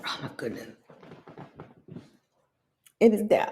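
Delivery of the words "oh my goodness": faint, strained gasp; choking on candy. Real, not acted. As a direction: barely get the words out. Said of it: "I think it went down the wrong".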